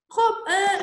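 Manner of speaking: usual speaking voice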